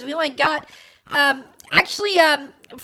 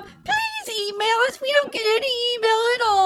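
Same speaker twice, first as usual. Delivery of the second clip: whiny; 6 semitones higher than usual. Real, not acted. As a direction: beg for emails in a whiny voice. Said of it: "God, you sound like a whiny little bitch".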